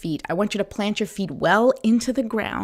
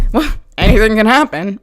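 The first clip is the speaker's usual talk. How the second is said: louder, uneasy anything can happen; fearful dread; 9 dB louder than usual. Shown as a demonstration. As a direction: say it as a worried warning. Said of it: "as opposed to".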